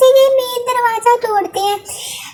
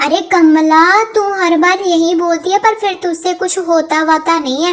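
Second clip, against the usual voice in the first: a lower, grumbling sidekick voice; low-voiced partner; 6 semitones lower than usual. Partner nagging Kamla about the screwdriver, lowered voice.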